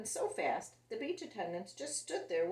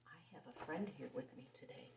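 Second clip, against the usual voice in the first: hushed secret aside; whisper; 3 semitones lower, 15 dB quieter, slower than usual. Real, not acted. Storyteller whispering to viewers about a surprise guest. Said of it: "aside to viewers".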